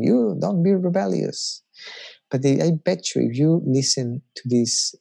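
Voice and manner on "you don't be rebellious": raised pitch, a mock stern rebuke; stern God scolding. Imagined God scolding the preacher, hint of stern. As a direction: wag a finger and scold. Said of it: "pointing his finger at me and saying".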